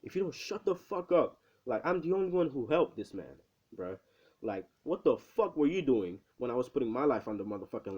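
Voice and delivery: fired-up retort with emphatic swells; indignant tirade. Retold or imitated. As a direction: tell the heroes off, punching the key words. Acted tirade at the scolding heroes.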